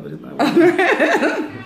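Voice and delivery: laughing. Real, not acted. Laughing at failed live.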